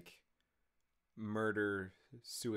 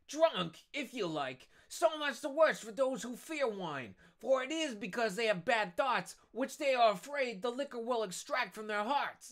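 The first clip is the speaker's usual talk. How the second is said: high-pitched tipsy character voice; high, drunken self-righteous speech; indignant drunk; 11 semitones higher, 4 dB louder than usual. Acted. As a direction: argue boozily for the love of wine. Drunk Caderousse defending wine.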